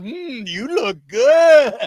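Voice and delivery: mock hungry farmer. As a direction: eye the chicken like dinner, slowly. Host mimics Andy sizing up chickens.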